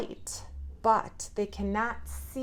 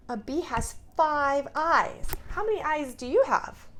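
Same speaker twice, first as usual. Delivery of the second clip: excited; 7 semitones higher, 7 dB louder than usual. Acted. Teacher quizzing children enthusiastically.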